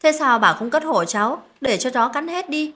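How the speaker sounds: a touch of exasperation, pitch climbing at the end; snappish. A narrator's reading. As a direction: snap back accusingly, mildly acted. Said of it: "Tôi lại gắt lên".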